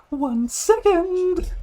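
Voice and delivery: high, drawn-out sing-out from indoors; high-pitched holler to someone at the door; calling out. Acted. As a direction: call out loudly, stretching the words. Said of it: "you hear someone call out".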